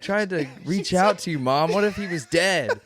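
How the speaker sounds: laughing mock plea. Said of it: "Be like".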